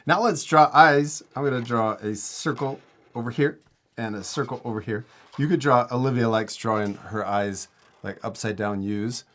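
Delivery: usual speaking voice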